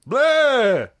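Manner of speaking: high hammy vampire bleh; a silly monster blurt. Pretend. Monster noise after scream joke.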